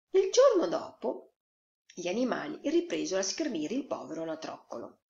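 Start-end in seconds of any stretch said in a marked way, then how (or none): none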